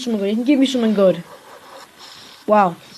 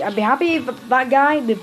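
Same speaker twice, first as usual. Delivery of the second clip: normal talking voice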